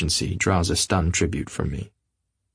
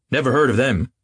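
decisive; 5 semitones higher, 3 dB louder than usual. Acted. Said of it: "he remarked decisively".

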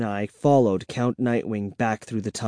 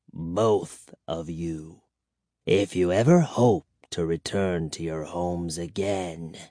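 soft vampire threat; 6 semitones lower, 5 dB quieter than usual. A narrator's reading.

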